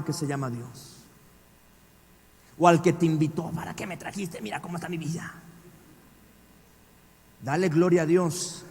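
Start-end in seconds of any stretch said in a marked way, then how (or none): 3.5-5.3 whispered grumble, no voice; breathy whispered reproach; hushed complaint. Retold or imitated. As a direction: whisper it bitterly to yourself. Blaming whoever invited you.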